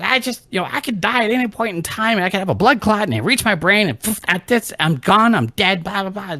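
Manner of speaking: high-pitched dramatic fretting; melodramatic mom. Retold or imitated. Mom claiming she could die, high melodramatic imitation. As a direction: rattle off mom's doom in a high voice. Acts out mom's melodramatic complaint.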